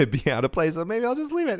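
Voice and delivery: high, laughing aside; amused chuckling. Real, not acted. Host giving up on editing out the cough, amused chuckling. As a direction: laugh at your own muddle as the pitch climbs.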